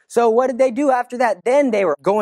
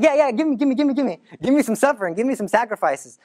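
put-on eager kids; 3 semitones higher, faster than usual. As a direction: beg for it like excited kids.

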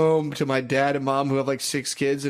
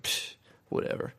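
dismissive whisper; 9 dB quieter than usual. Retold or imitated. Cocky drunk teen retold.

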